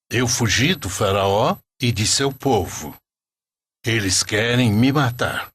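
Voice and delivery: usual speaking voice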